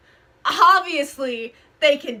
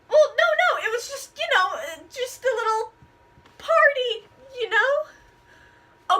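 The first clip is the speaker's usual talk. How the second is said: sheepish; 8 semitones higher than usual. Acted. Caught out, sheepish excuse.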